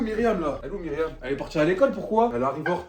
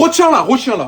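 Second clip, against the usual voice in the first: loud, higher order; urgent shout; 6 semitones higher, 10 dB louder than usual. Acted. Brother shouts to hold the girl back. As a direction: stop her now, shout it.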